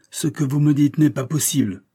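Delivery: loud, high disbelieving cry; outcry. A narrator's reading. Banker cries out in disbelief, loud. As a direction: refuse to believe it aloud. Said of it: "s'écria-t-il".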